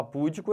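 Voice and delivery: "púdico" stressed on PÚ; wrong first-syllable stress. Shown as a demonstration. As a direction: hit PÚ in "púdico", showing the error. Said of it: "errou tudo". ("púdico" com força no PÚ)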